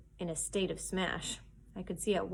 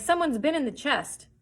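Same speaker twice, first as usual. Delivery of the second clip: higher, louder alarmed call; crying out; 7 semitones higher, 6 dB louder than usual. Acted. Pirate at the window calling down, loud. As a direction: shout it down from the window. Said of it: "He cried".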